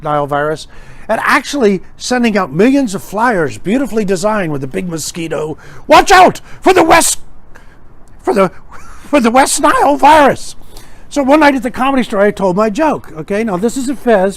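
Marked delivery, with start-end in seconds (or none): 5.9-10.5 high, loud hammed-up warning; mock alarm. Health flyer warning the public of mosquitoes, mock alarm. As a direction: sound a halting, overblown public alarm.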